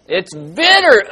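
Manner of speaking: slow, loud, drawn-out reaction; exaggerated disgust. Teacher confirms to children the water is bitter, loud exaggerated reaction. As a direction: stretch it out loudly in mock disgust.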